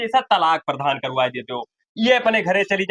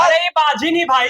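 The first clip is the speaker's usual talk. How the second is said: loud protest; 10 semitones higher, 4 dB louder than usual. Acted. Drunk son protests loudly.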